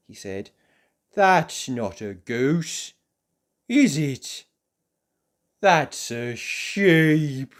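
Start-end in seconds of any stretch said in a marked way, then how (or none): 1.1-7.5 slow, drawn-out dawning embarrassment; sheepish realisation. Acted. Mister Forgetful realising his mistake in front of the farmer, slow and sheepish. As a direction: realise it slowly and awkwardly.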